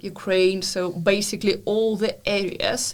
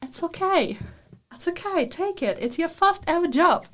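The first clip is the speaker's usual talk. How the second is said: lightly mimicked, higher reassuring voices; reassuring advisers; 9 semitones higher than usual. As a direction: imitate people coaxing her to take the job. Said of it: "and they told me".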